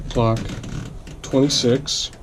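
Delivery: ordinary delivery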